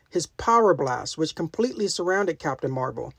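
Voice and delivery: ordinary delivery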